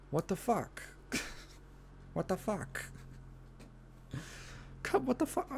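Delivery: high, incredulous what the fuck; exasperated disbelief. Real, not acted. Ranting to viewers about the studio, incredulous. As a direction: throw up your hands at the news.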